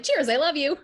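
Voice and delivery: high, loud cheesy gush; high sing-song loving chirps. Retold or imitated. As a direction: overdo the I love yous. Imitating her constant I love yous.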